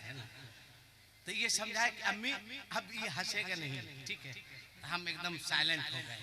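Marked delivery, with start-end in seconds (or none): none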